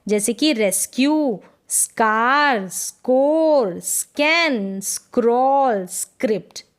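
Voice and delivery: रेस्क्यू, स्कार, स्कोर drawn out on sk; slow sk words. Shown as a demonstration. Teacher to class, slow sk model. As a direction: stretch each word to show the sk. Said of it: "स्क पढ़ रहे हैं".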